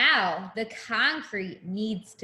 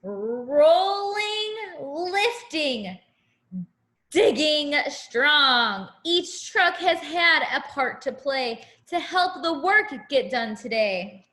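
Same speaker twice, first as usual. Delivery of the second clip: sing-song picture book rhyme; reading to a child; 5 dB louder, slower than usual. Acted. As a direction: read it like a bedtime rhyme.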